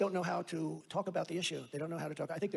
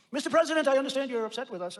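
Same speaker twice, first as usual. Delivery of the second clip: higher, louder eager voice of a caller; pleading foreign leader; 7 semitones higher, 4 dB louder than usual. Retold or imitated. Foreign leader calling the president, eager and louder. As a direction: play the anxious leader begging the president.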